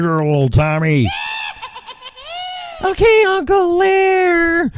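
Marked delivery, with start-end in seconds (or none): 1.1-2.1 put-on falsetto kid giggle. Tommy character giggles in falsetto. 2.8-4.7 put-on high squeaky kid voice, shouted and drawn out; little Tommy. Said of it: "little snot-nosed transgender Tommy".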